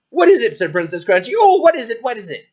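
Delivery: high, loud impatient asking; eager princess. Acted. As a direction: beg to know, high.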